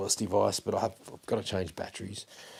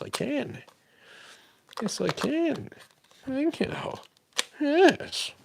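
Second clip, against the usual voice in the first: high-pitched; much slower than usual. Real, not acted. Cheering himself after fixing mic.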